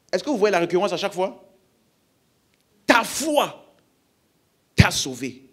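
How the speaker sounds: loud, high, rising preacher's call; fiery preaching. Real, not acted. Pastor stirring the congregation, loud and high. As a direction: hammer it home to the crowd.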